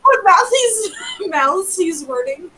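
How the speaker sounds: high, slow, laughing mockery of the slip; mocking laughing repeat. Player teases a friend's slip, laughing. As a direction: crack up while parroting the jumbled words. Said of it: "We know what he meant".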